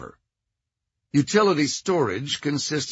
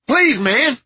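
loud, very high frightened plea; panicked man pleading at gunpoint; high-pitched nasal pleading; 12 semitones higher, 9 dB louder than usual. A narrator's reading. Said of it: "High-pitched, nasal voice".

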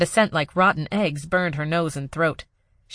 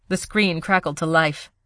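plain reading voice

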